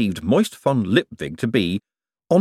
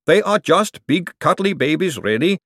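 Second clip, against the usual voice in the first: golem voice; 8 semitones higher, 4 dB louder than usual. A narrator's reading. Gladys teasing about men.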